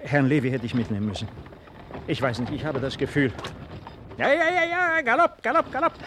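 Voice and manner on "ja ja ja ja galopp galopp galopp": high, loud call of galopp, galopp; a rider shouting his horses to gallop; urging the horses. Acted. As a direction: drive the horses on with your voice.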